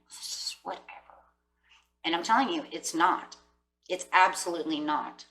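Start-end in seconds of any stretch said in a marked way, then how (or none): 0.1-0.8 whispered shrug. Real, not acted. Dismissive aside.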